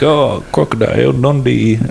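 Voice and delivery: put-on slow, drawn-out Australian accent; mock Australian movie-hero voice; funny Australian accent. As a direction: imitate Crocodile Dundee with a broad Aussie accent. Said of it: "He had a funny accent".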